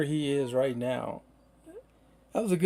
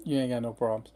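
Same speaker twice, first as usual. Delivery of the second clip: usual speaking voice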